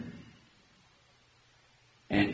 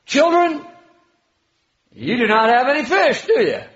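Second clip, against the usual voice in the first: high, loud teasing question with a laugh; laughing tease, pitched way up; teasing Jesus; 10 semitones higher, 7 dB louder than usual. Retold or imitated. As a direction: needle them playfully, laughing. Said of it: "Jesus therefore said to them".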